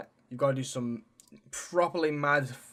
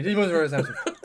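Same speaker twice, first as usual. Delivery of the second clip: laughing; 4 semitones higher, 6 dB louder, much faster than usual. Real, not acted. Laughing through the repeat.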